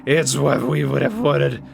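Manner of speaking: unsettling changed voice, slowing down; eerie, hungry character voice; creepy craving voice. Acted. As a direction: sound hungry and unhinged. Character craving more meat.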